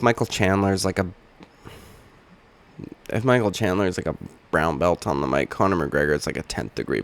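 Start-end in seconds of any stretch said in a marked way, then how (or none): none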